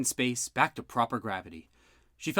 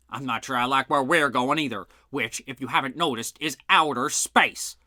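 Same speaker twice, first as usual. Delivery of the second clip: louder echo, mocking Bud; a red-faced cop sneering back at a suspect; sarcastic sergeant; 3 semitones higher, 7 dB louder than usual. A narrator's reading. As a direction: throw his words back at him. Sergeant mocks Bud's words back.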